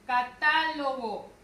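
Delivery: «Catálogo» lento, TÁ fuerte y alargada; acento en TÁ. Shown as a demonstration. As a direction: carga la voz en la segunda sílaba de «Catálogo». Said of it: "la mayor fuerza de voz recae en ta". («Catálogo» con TÁ sostenida)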